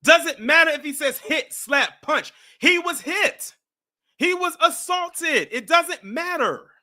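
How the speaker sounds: loud, high, slow yelling; furious shout. Real, not acted. Yelling at the other host that it was assault, furious. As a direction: blast him, spelling out each word.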